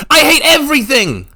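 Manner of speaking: very high, very loud scream of hatred. A narrator's reading. Revna screams her hatred at the monk. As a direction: scream it with everything. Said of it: "she screamed".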